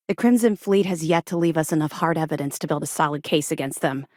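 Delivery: ordinary delivery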